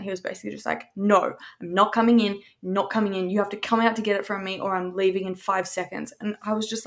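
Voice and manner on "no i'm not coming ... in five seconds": ordinary delivery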